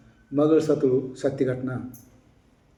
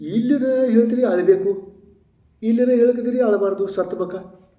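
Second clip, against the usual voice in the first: confused complaint; 6 semitones higher than usual. Retold or imitated. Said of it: "ಅನ್ಕತ್ರು".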